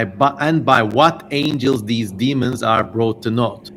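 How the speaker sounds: ordinary talk, nothing marked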